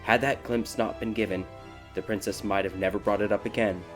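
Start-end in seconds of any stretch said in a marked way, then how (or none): none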